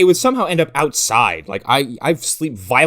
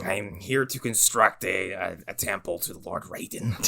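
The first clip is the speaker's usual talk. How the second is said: Christopher Lambert; 9 semitones lower, 8 dB quieter than usual. Acted. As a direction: intone it deep, trailing softer. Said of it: "ended with you as Christopher Lambert".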